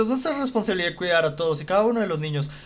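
usual speaking voice

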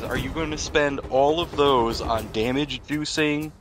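ordinary talk, nothing marked

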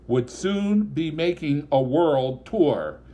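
ordinary delivery

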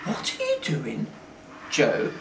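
lightly voiced puzzled girl; girl questioning him. A narrator's reading. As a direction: ask him in soft bafflement. Lauren questions Joe, baffled.

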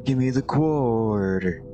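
slow, greedy little mouse voice. Acted. Player stuck in the mouse voice demanding the quarter, slow. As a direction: drag it out like a greedy mouse wanting its coin. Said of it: "Get stuck doing the mouse voice".

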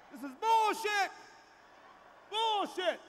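high, loud and drawn-out; exasperated outburst. Acted. Comedian yelling bullshit at the crowd, loud and high. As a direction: stretch out bullshit in disgust.

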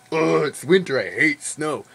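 mock whiny complaint about winter; mock complaining. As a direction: whine it like a grumpy Wisconsinite. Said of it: "that's how the people are in Wisconsin".

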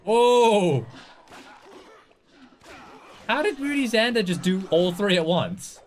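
high, loud, drawn-out amazement; astonished. Real, not acted. Player amazed at what Moody Xander did, astonished. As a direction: cry out in disbelief.

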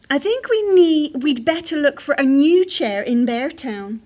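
loud, decisive dad bear voice; Father Bear. A narrator's reading. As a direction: announce the plan in a big voice. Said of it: "said Father Bear".

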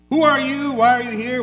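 loud, high, challenging questions; a ruler's loud mocking challenge; demanding shout. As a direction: demand it from Jesus like a skeptical king. Voicing Herod's demanding questions.

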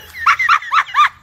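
a sudden high, loud burst of laughter; shrieking laugh. Real, not acted. Mother can't hold back a loud laugh at the maid remark. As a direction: shriek with laughter before catching yourself.

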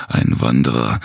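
ordinary delivery

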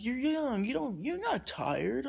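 high mock protest, slowing at the end; imitated protest. Stranger disputing that he's tired, imitated high protest.

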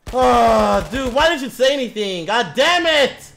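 loud, high frustrated outburst at a teammate; angry yelling. Real, not acted. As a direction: chew out the teammate, the last words slow. Blaming teammate after death.

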